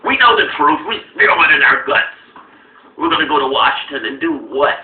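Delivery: loud, hammed-up zealot rant; imitating a fervent pundit, loud; mock zealot rant. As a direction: rant it like a true believer. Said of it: "and now it's".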